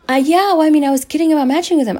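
high, loud imitation of niece; niece confessing. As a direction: own up breezily as the niece, high. Niece owning up in retold argument.

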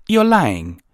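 high, loud indignant accusation. A narrator's reading. Twilight accuses the armored pony, high and indignant. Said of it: "Twilight said indignantly".

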